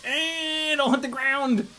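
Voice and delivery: high, strained yelp of alarm; mock panic. Pilot acting out the panic throttle at the move's end, mock panic. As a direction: act out a panicked squeal. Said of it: "is just like panic throttle".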